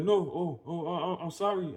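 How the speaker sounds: imitating a stranger's high, stammered apology; flustered apology. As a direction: act out a startled backpedal. Voices stranger apologizing after being corrected.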